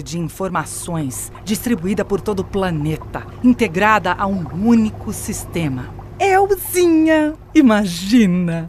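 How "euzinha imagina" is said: high, very slow, savoring each word; gleeful gloating. Acted. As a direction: stretch it out, delighted with herself. AI gloating about her future.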